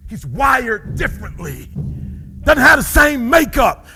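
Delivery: loud, high, driving preacher shout; preaching at full force. Acted. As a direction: preach it up to a roar.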